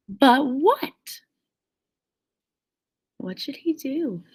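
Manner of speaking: usual speaking voice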